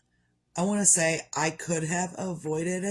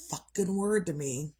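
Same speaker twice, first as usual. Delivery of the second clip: normal talking voice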